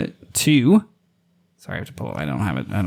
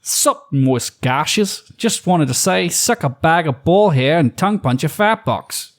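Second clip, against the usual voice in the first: crude Aussie rant in a mock accent, first words very slow; mock Australian read; over-the-top Australian; 4 semitones higher, slower than usual. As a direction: ham up a vulgar Australian bloke. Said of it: "This is not his accent".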